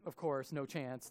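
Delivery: normal talking voice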